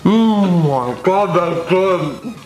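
amazed, delighted high voice; excited. Real, not acted. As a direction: blown away by the taste. First bite of sour burrito.

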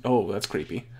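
ordinary talk, nothing marked

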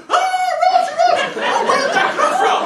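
very high, loud shriek; feigned panicked scream. Acted. Guy pretending shock at his own roach, shrieking. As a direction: scream along in mock horror.

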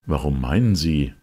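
usual speaking voice

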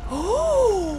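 high, loud "oh" held out; long high-pitched yelp of shock; startled cry. Real, not acted. Startled as hands drag them in.

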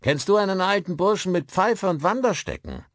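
loud, high-pitched shouted question; calling out. A narrator's reading. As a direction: holler it across the pub. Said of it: "rief er".

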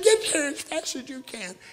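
high, loud panicked plea; wife frantically calling the preacher on the phone; frantic scream. Retold or imitated. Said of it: "She's screaming on the phone".

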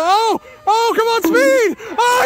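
high, loud cheering with a cry in it; excited yelling. Real, not acted. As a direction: urge the runner on at full voice. Cheering a runner home.

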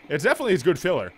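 usual speaking voice

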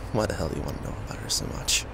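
usual speaking voice

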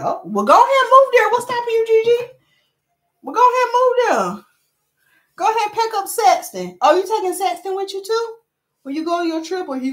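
high, loud playful challenge to a viewer; mock-excited teasing. Real, not acted. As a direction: egg her on, high and loud. Teasing viewer about moving away.

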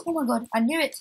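startled, voice jumping up; gasp of alarm. Real, not acted. Player spotting the zombie he feared, gasping loudly.

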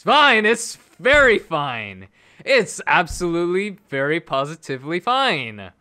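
high, very loud insistence; exploding forced positivity. Real, not acted. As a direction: shout that you are okay, clearly not okay. Forced positivity erupts into shouting.

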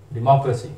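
डेमोक्रेसी as di-MOCK-ruh-see; British pronunciation. Shown as a demonstration. Teacher giving learners the correct word, British di-MOCK-ruh-see for डेमोक्रेसी. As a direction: stress the rounded "mock" in डेमोक्रेसी. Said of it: "जो British pronunciation है वो".

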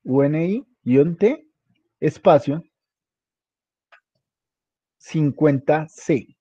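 measured dictation, leaving time to type; slow spelling dictation. Real, not acted. Teacher dictating a model name to a student, slow dictation. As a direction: spell it out slowly, letter by letter.

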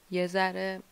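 ordinary delivery

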